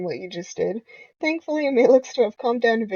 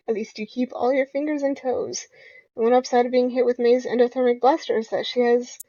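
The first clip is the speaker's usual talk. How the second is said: usual speaking voice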